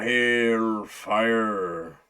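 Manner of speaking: mock drawl on well hellfire; jokey put-on accent; terrible accent. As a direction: put on a hammy country accent. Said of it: "That's a terrible accent".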